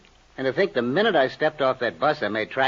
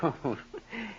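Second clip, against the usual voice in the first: short mocking laugh; brief amused scoff; 3 semitones higher, 11 dB quieter than usual. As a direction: laugh off his threat. Blackmailer scoffs at the idea of work.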